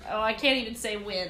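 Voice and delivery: ordinary talk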